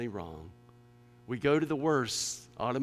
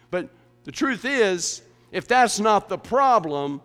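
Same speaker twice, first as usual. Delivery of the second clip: louder, driving sermon voice; full-force preaching; 7 semitones higher, 9 dB louder than usual. Real, not acted. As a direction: preach it hard to the congregation.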